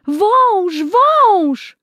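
put-on high, loud terrified girl's scream; frightened scream. Girl running from a snake, screaming high and loud. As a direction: shriek it in fear as a little girl. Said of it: "krzyczała".

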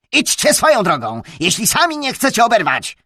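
loud, high angry nobleman; threatening. A narrator's reading. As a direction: warn them off with a snarl.

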